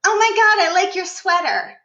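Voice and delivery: high, loud, gushing small talk; gushing compliment. Pretend. Coach mocking forced flattery in a high, loud gush. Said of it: "without even having to say".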